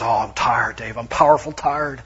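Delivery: a light imitation of a tired Texas accent; lightly put-on Texas accent, weary friend. Seminary buddy Max from Texas answering how he is, light Texas accent. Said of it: "that wretched accent of mine".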